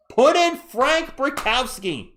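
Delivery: high, loud, drawn-out holler; stretched-out shout, falling at the end; rowdy call-out. Real, not acted. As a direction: yell it to the coach like a fan. Calling for a substitution.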